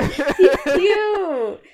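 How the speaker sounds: high, loud, laughing; laughing gush. Real, not acted. Fan gushes over the doll, laughing. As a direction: squeal it through a laugh.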